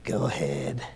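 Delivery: low, slow growling menace; Clint Eastwood. Retold or imitated. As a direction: mutter it like a gunslinger. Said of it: "Clint Eastwood stare".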